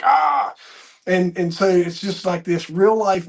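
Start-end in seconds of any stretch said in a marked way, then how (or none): 0.0-0.5 yell. Retold or imitated. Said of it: "he would yell".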